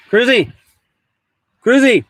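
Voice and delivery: loud high call across the van. Real, not acted. Owner calling his dog loudly across the van. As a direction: call the dog's name loudly.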